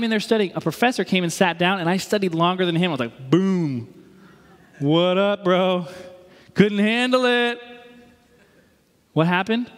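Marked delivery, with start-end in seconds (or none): 3.3-7.6 cocky, drawn-out victory taunt; slow, swaggering mock trash talk; mock gloating. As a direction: crow over a beaten rival, hammed up. Mock gloating over outlasting professor.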